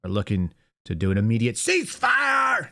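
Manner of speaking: shouting out the all-caps post; reading all caps. Shown as a demonstration. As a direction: shout it the way it's typed. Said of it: "so I read it as such".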